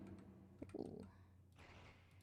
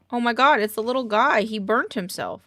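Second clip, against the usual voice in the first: alarmed shout; 2 semitones higher, 9 dB louder than usual. Real, not acted. Shocked at something on fire.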